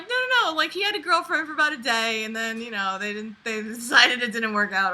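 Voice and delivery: put-on high insistent kid voice; mock little kids. Cousins insisting about the one-day girlfriend, high mock kid voice. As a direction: pile on like eager kids. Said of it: "they were like".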